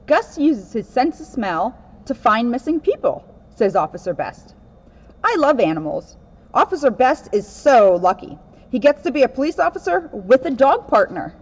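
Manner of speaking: lively read-aloud storybook voice; picture book reading, lightly animated; reading to children. Acted. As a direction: read it to a room of kids.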